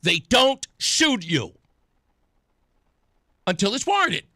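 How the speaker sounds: loud, high, pointed retort; real exasperated shout; heated retort. Real, not acted. Host shouting back at the clip.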